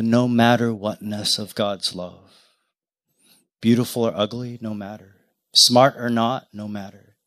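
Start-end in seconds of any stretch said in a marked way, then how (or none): 4.6-5.2 hushed refrain. Real, not acted. Quiet refrain in prayer. 6.5-7.2 hushed refrain. Real, not acted. Quiet refrain in prayer.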